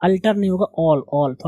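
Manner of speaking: ordinary delivery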